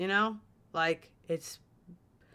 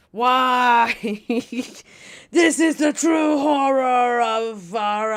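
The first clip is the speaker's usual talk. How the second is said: hammed-up slow dramatic cry, louder; mock horror; 6 semitones higher, 9 dB louder, slower than usual. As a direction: play it like a horror trailer. Mock horror at ship prices.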